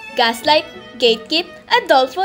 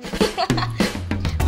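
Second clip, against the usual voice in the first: breathy mocking laugh; 5 semitones higher, 7 dB quieter than usual. Mocking villain-style ad laugh.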